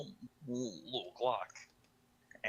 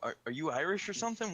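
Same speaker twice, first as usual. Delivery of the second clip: higher, quicker incredulous question; mock puzzlement, pitched up and hurried; baffled onlookers; 7 semitones higher, 4 dB louder, faster than usual. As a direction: imitate bewildered people asking. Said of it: "People are like, "What?".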